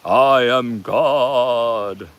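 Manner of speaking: booming, drawn-out godly proclamation; mock-grand, very slow deity voice. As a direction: stretch it out slowly like a god. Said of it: "then there's the voice of".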